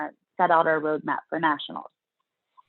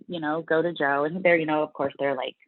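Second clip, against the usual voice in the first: ordinary delivery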